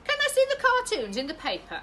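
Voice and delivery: put-on high, loud eager girl; high child voice. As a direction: ask like a keen little girl. Girl asks mum.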